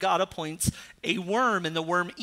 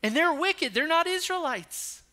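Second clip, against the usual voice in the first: a whiny, outraged Jonah climbing higher; Jonah's indignant protest; 6 semitones higher than usual. Retold or imitated. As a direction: play Jonah getting worked up against forgiveness. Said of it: "he said".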